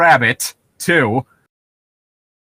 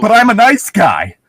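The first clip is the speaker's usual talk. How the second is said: higher, louder sneering "nice guy"; mock nice guy; 6 semitones higher, 5 dB louder than usual. Punchline mocking the nice guy, higher and louder. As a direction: lean on nice with mock pride.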